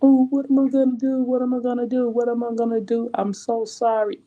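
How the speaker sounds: high, fast whiny mock sobbing; imitating a starlet wailing helplessly. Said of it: "she was actually crying and crying and saying".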